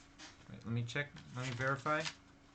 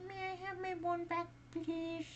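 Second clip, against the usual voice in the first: small ghostly voice. Acted. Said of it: "she heard the voice again".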